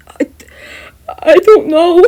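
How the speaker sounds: high, loud scared reply; frightened. Acted. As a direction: answer in panic. Terrified Mineta.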